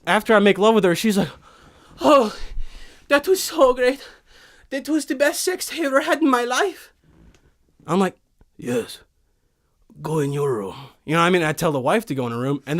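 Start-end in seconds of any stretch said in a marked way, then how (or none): 2.0-6.9 swooning wife voice. Pretend. Wife after sex. 8.6-10.8 Viking voice. Pretend. Viking dismisses the wife.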